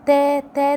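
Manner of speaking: تا held long each time; drawn-out تا, searching for a word; long alif. Shown as a demonstration. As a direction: stretch تا out twice. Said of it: "كلمة فيها صوت التا".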